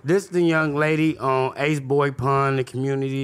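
slow, low, grand introduction voice; a hint of a stately host introducing a guest; formal announcer voice. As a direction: announce her slowly like a host. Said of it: "Why you talking like that".

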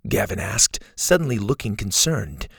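plain reading voice

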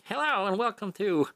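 high, projected vintage radio host greeting; thirties-to-fifties radio host; old-time radio voice. Acted. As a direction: pitch it up like an old radio announcer. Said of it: "like, radio voices".

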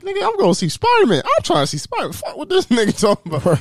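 high-pitched impatient excitement; worked-up retold outburst, pitch shooting up; hyped. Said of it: "I'm hyped".

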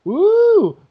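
loud high woo shouted out; excited whoop. Real, not acted. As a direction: let out a big happy whoop.

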